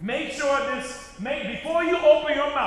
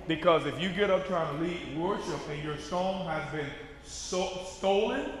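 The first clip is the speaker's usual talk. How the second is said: ordinary talk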